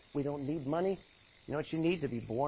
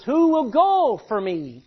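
loud, ringing call from the throne; God crying out, booming; 9 semitones higher, 13 dB louder, slower than usual. Acted. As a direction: call out to the crowd. God's call voiced loudly.

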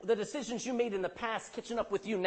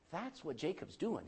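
ordinary talk, nothing marked

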